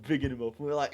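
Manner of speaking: ordinary talk, nothing marked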